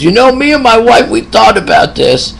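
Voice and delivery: high, lightly put-on old man's voice; elderly patient. Old patient quoted by nurse.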